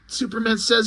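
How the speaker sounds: ordinary delivery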